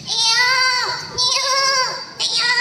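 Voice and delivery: put-on high, wailing baby cries; high-pitched baby wail; baby crying. A narrator's reading. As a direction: imitate a crying infant. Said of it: "suara tangisan anak bayi".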